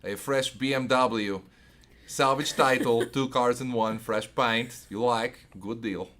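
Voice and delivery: put-on salesman in a Russian accent; slow, hammy Russian car-dealer pitch. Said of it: "a good Russian accent when required".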